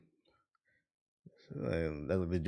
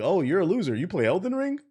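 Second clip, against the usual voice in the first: mocking; 8 semitones higher than usual. Mocking people who judge gamers.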